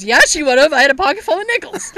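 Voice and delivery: loud, high, quick comeback imitating dad; boastful retort. Dad answering the jab about mom, boastful. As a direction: fire back loudly with mock pride.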